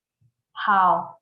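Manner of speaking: 好 said slowly with a dipping third tone. Shown as a demonstration. Teacher to class: slow model word. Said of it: "literally means good".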